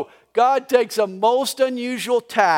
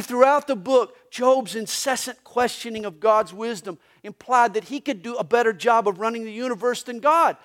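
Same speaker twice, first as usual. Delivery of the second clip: ordinary talk, nothing marked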